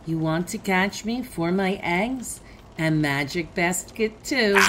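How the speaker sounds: ordinary delivery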